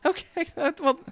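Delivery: very high sobbing whine; high whiny crying man. Acted. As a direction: blubber like a hurt kid. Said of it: "He's still crying".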